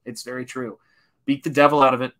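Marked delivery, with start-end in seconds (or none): 1.2-2.1 playful shout. Acted. Bob Ross catchphrase, shouted playfully.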